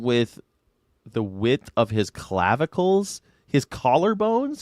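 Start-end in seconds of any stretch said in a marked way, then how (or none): 3.5-4.6 high, disbelieving question; pitched way up in disbelief; incredulous. Real, not acted. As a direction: ask it in disbelief. Incredulous reaction to name.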